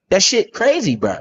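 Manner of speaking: normal talking voice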